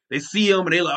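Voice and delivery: ordinary talk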